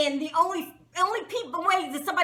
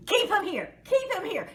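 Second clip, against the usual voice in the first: godfather-style yell; 7 semitones higher than usual. Retold or imitated. Woman retold ordering him held, mock godfather yell. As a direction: imitate someone yelling back like a mob boss. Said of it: "she yells back as if really she's the godfather".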